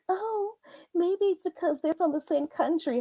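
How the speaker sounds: soft high mocking voice; high whiny mock of the gossip; mocking them. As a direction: mimic their gossip softly. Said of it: "Talking about".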